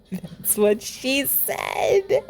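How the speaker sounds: slow, teasing drawl on the joke; milk the punchline slowly; cheeky innuendo. Acted.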